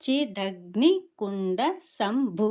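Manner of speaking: చిదగ్ని కుండ సంభూ slowly, with an aspirated భూ; careful recitation. Shown as a demonstration. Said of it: "అనేసని పలకాలి".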